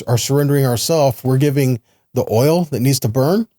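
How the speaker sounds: normal talking voice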